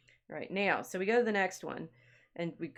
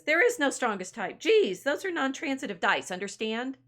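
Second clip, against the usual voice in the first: high, loud, mock-impatient retort; exasperated correction; 6 semitones higher, 5 dB louder than usual. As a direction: act out an irritated know-it-all putting someone right.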